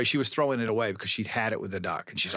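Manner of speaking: normal talking voice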